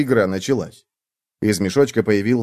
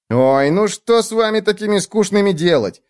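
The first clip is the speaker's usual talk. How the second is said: high-pitched whiny schemer; high, put-upon little bug voice; exasperated bedbug; 10 semitones higher than usual. A narrator's reading. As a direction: lament how boring friends are, high. Bedbug character complains to friends.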